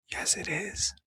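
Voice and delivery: hushed whisper of a reply. Retold or imitated. Son answering his father in a whisper. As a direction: breathe it out as a whisper. Nick's quote in retold scene.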